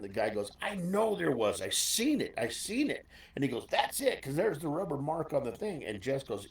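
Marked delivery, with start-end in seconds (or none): 0.6-3.1 mock protest, pitched higher; imitating the insistent manager. Building manager insisting board was bent. 3.7-5.8 mock protest, pitched higher; imitating the insistent manager. Manager pointing out the rubber mark.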